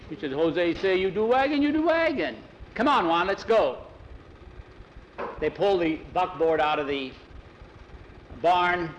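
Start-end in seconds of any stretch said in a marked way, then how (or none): 0.3-3.8 put-on high, loud accented order; Mexican accent. A narrator's reading. Pedro pushing Jackie with Jose's order, loud and high. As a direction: insist in the boss's accent.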